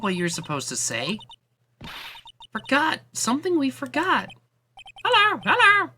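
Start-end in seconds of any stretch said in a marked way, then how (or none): 2.5-5.9 very high screeching parrot hello, loud; squawking parrot. Acted. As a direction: shriek it like a parrot. Parrot babbles.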